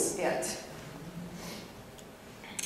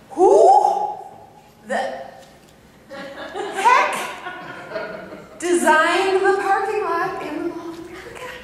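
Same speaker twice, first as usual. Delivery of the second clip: exasperated rant; 7 semitones higher, slower than usual. Real, not acted. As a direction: rail at the parking lot designer. Ranting about the parking lot.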